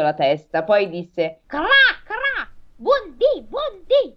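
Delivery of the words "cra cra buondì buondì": high, squawky crow voice; talking crow, pitched way up; cawing crow. Acted. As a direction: caw the words slowly up high like a crow. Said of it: "una grossa cornacchia saltellò".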